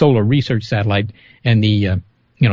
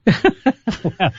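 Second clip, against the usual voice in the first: laughing; 8 semitones higher than usual. Real, not acted. Laughs at the movie joke.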